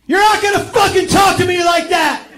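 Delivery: put-on screaming with rage at his girlfriend; furious scream. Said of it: "he screamed".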